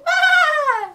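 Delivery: high loud yelp; sudden high yelp; cry of pain. Acted. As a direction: yelp as you hit the ground. Falls down on landing.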